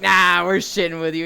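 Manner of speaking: loud, jokey mock-cop dismissal; imitated officer waving it off loudly; prank reveal. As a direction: burst out with the reveal. Retold police reveal the prank.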